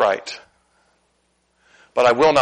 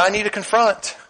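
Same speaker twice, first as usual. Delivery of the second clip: ordinary talk, nothing marked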